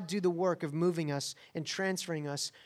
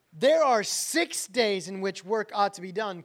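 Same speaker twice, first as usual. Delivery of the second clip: indignant ruler; 2 semitones higher, 7 dB louder than usual. Retold or imitated.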